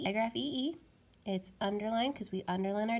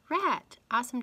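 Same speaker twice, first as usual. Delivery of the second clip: rat said high and loud with a big lift; teacher reveals rat excitedly; excited answer; 4 semitones higher, 5 dB louder than usual. Shown as a demonstration. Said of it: "Change the p to r".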